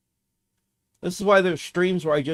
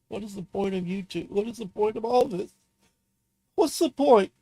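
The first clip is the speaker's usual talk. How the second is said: crying despair, building to a cry; 6 semitones higher than usual. Real, not acted. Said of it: "mock me for crying on stream".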